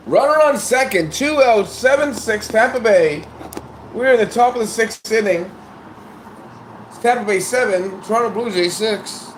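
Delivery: high, loud announcer calling the game; play-by-play call. Acted. Announcer reading the score of the game to listeners. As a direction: call the inning like a radio announcer.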